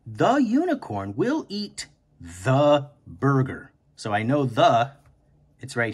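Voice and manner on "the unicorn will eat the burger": sentence said slowly and evenly; slow teacher rereading. Shown as a demonstration. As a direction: reread it slowly to track the place. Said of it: "we say the word over and over".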